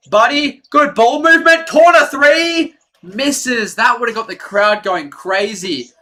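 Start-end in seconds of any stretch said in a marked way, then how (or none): 0.1-2.7 excited call. Real, not acted. Calling a corner three.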